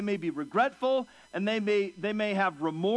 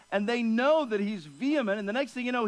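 usual speaking voice

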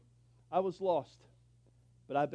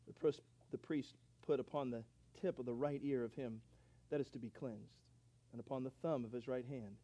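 soft, measured reading of the verses; scripture reading; 4 semitones lower, 5 dB quieter than usual. Acted. Preacher reading Leviticus aloud to the church, quiet and measured. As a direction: read the passage quietly and deliberately.

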